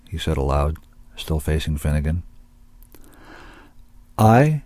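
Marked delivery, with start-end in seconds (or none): 4.2-4.6 higher, carefully placed opening word; clear and precise. A narrator's reading. Finnegan reveals himself as an agent, clear and precise. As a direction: announce it with precise diction. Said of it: "said clearly and precisely".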